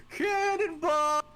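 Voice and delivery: high, loud, drawn-out holler; gleeful battle-cry shout. Acted. Satorvik jumping into the river, long high shout. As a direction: holler it long as you leap.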